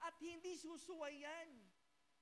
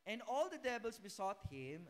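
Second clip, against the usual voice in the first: loud, deliberate Bible reading; scripture reading; 3 semitones lower, 6 dB louder than usual. Acted. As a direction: proclaim the verse to the church. (malakas na pagbasa ng Bibliya)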